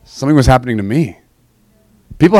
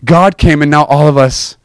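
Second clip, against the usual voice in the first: ordinary talk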